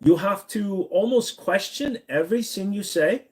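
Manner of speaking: put-on German accent, rising at the end. Shown as a demonstration. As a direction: demonstrate a German accent, lifting the voice high to finish. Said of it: "But the German".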